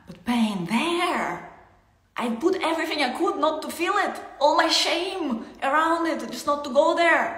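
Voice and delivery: loud, high, pained outburst; anguished part of the self crying out; acted distress. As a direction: cry out from the place that hurts. Voicing the hurting part.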